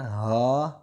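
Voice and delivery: ہا said heavy and from the throat. Shown as a demonstration. Teacher showing the throaty ہا to a student, loud. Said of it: "یہ ہا بھاری آواز میں پڑھنا ہے".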